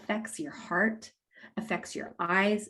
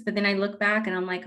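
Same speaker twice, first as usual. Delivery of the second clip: ordinary delivery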